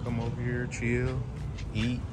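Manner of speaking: ordinary delivery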